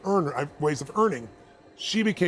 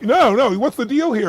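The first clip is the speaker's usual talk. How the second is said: loud, higher pushy demand; insistent haggling; 7 semitones higher, 8 dB louder than usual. Retold or imitated. Wife insisting to the clerk, loud and higher. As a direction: press for the deal. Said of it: "she's like".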